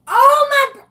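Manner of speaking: overwhelmed, fighting back tears; tearing up. Real, not acted. Host hearing a teacher's thirty years, crying out. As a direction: voice breaking into a high crying cry-shout. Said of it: "I'm not gonna cry on here today".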